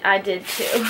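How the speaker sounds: usual speaking voice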